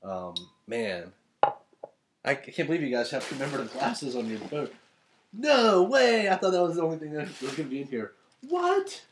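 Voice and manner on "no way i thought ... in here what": high, loud squeal of disbelief; stunned delight. Real, not acted. Opening the crew's gift, a high, loud squeal of disbelief. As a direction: shriek it out like you can't believe the gift.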